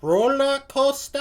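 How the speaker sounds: high, loud imitation Geordie; Geordie accent attempt. Viewer copying the comedian, in an attempted Geordie accent. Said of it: "I'm actually trying so hard".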